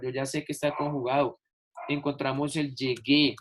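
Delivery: ordinary talk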